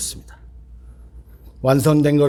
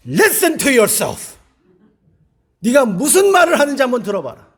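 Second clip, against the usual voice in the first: shouted rebuke; 11 semitones higher, 7 dB louder than usual. Retold or imitated. A pastor shouting at a sister to hear herself, loud rebuke. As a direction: shout to shake her awake. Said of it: "소리를 질렀어요".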